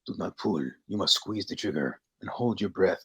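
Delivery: lightly voiced game tutorial narrator; Call of Duty tutorial. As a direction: talk her through the shot like a video game trainer. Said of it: "it sounds like something you hear in Call of Duty".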